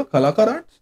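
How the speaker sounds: imitated query, कलाकार arts lifting at the end; questioning echo. Retold puzzled echo question.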